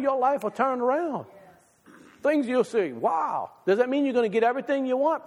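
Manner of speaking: high-pitched, rising preacher's cry; fervent preaching. Acted. A preacher promising his congregation better lives, fervent and high. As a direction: lift the congregation with a soaring plea.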